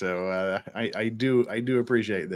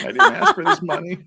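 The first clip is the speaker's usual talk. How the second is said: laughing; 10 semitones higher, 8 dB louder than usual. Real, not acted. Laughing at super chats.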